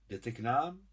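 pleasantly surprised question, rising at the end; pleasant surprise. A narrator's reading. Gulia greets an unexpected visitor, pleasantly surprised. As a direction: ask with mild, welcome wonder. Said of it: "V hlase měla příjemný údiv".

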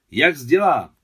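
high, loud excited cry; shout. A narrator's reading. Boy reacts to thunder close by, loud excited shout. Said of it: "křikl Miťka".

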